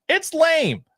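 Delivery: squeaky high voice. Shown as a demonstration. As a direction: jump way up into a squeak. Repeats line two ways.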